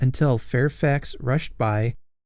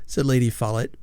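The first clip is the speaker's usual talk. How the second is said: usual speaking voice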